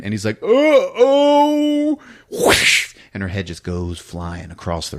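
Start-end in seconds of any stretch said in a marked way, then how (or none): 0.4-2.0 high, loud, drawn-out yell; hammed-up long howl; slow-motion cry. Slow-motion cry before blow. 2.3-2.9 loud breath-only swish; airy slicing noise; imitated whoosh. Imitates sword swing.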